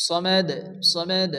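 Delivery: সমেদ with a bouncing final d; qalqalah on dal. Shown as a demonstration. Teacher demonstrating qalqalah on dal, bounced d in সমেদ. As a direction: echo the d ending সমেদ. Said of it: "এখানে কলকলা করতে হয়".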